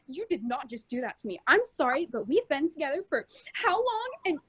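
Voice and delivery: mildly hurt sitcom partner; light sitcom drama; sitcom breakup. Pretend. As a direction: play the betrayed sitcom lover, understated.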